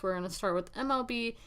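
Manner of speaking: usual speaking voice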